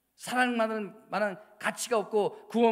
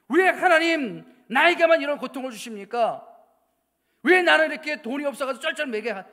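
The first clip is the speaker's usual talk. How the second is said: loud, indignant cries of why to God; complaining to God; 5 semitones higher, 10 dB louder than usual. Retold or imitated. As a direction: hurl the complaint at heaven. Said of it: "하나님에 대한 원망을 가지고".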